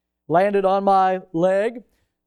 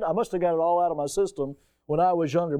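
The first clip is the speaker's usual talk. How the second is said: ordinary talk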